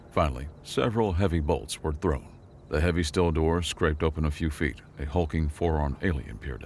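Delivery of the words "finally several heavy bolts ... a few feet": ordinary delivery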